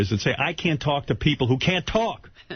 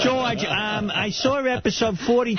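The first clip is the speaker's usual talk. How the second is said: high, nasal-free mock nerd voice, raised pitch; geeky fan; 10 semitones higher, slower than usual. Host mocking a Trekkie fan, high eager nerd voice.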